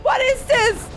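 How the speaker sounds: high-pitched, frantic protest; flustered panic. Real, not acted. Player can't take a call mid-fight, panicked. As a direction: plead in rising panic, voice held high.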